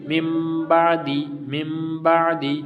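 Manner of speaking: من بعدی slow, with a held m and light دال; slow, exact teaching recitation of من بعدی; recitation demo. Shown as a demonstration. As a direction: recite من بعدی syllable by syllable, drawn out. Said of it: "دال اور تا".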